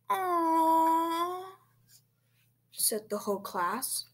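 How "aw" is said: higher, louder drawn-out aw; disappointed groan. A narrator's reading. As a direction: groan like a let-down class. Whole class disappointed at cancellation.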